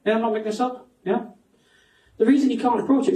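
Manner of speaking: ordinary talk, nothing marked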